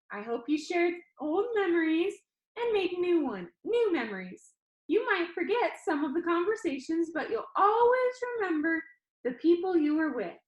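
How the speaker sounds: perky showman pitching to his audience; cheerful Danny. Acted. Danny on video teasing the funeral crowd, bright and upbeat.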